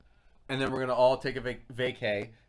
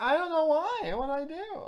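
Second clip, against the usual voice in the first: put-on high-pitched bewildered child voice; little kid. Little kid who can't see why he's hated, bewildered. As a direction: plead innocence in a small high voice. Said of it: "like that little kid's like".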